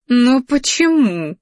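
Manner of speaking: high, drawn-out whine; whining. A narrator's reading. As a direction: whine it like a thwarted lover. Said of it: "загундела она".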